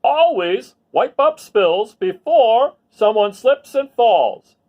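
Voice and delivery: loud, high and slow instruction; officer's safety tip. Acted. Officer Buckle giving a safety tip to the children, loud and slow. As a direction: lay down the rule slowly and loudly. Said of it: "said Officer Buckle".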